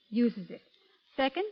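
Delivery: ordinary talk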